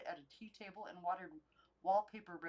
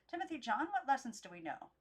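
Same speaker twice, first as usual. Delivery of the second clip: lightly voiced prim doll; 6 semitones higher, 6 dB louder than usual. Doll voice Christabel.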